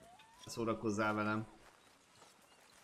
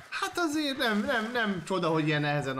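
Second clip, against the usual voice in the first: surprised realisation; 7 semitones higher, 3 dB louder than usual. Real, not acted. Startled reaction to game event.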